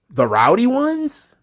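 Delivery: slow, high disbelieving question; incredulous. Acted. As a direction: ask it as if it can't be real. Mocking the fan base name.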